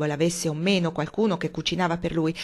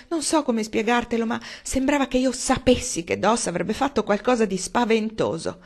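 higher, louder wailing lament; fretful mother in a panic; distraught mother; 6 semitones higher, 4 dB louder than usual. A narrator's reading. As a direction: wail it, hands wringing. Mrs Frederick protesting to James.

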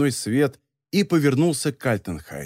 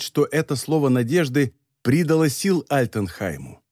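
ordinary delivery

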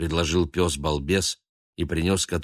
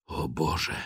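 softer, lower stunned murmur; hushed gasp; 3 semitones lower, 6 dB quieter than usual. A narrator's reading. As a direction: breathe it out in shock. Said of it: "прошептал папа".

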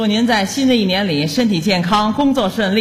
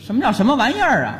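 ordinary talk, nothing marked

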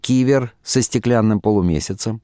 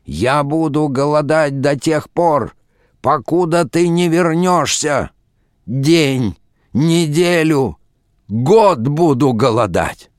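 high, slow theatrical wail; crying out; 6 semitones higher, 3 dB louder, slower than usual. A narrator's reading. Unhappy husband threatens starvation to wife, wailing. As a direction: declaim the threat in drawn-out, mounting cries. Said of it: "закричал несчастный муж".

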